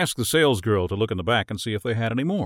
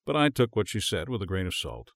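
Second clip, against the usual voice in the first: plain reading voice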